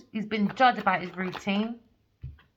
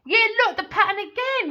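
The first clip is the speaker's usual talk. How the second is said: high, loud voiced lament; excited figure voice; 12 semitones higher, 9 dB louder than usual. Tarot reader voicing the king, high and loud.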